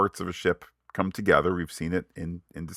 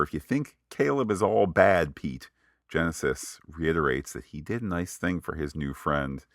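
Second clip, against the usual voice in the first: usual speaking voice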